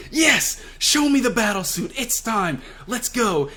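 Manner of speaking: Queen thrilled at the battle suit; excited villain. Acted. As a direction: egg on the fight with glee. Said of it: "only gets Queen more excited".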